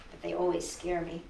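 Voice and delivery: plain reading voice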